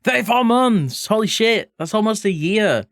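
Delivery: high-pitched delighted exclamation; excited amazement. Real, not acted. Streamer reacting to a viewer's resub, excited and high. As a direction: exclaim it high, thrilled by the milestone.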